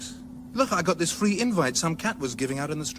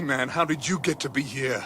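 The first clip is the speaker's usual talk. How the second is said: ordinary talk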